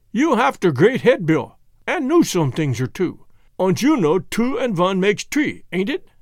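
put-on emphatic Germanic-accented bargainer; thick foreign accent, lively and insistent; Jan with Germanic accent. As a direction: lecture them in broken English. Jan reasons with Bill.

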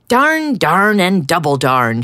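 loud, frustrated duck shout. Acted. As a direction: shout the darns out. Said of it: "shouted Duck".

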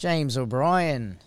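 slow, put-on Australian accent. Shown as a demonstration. Co-host trying a name on request, drawn-out Australian accent. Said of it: "say James O'Brien in an Australian accent".

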